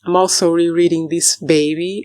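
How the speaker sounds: usual speaking voice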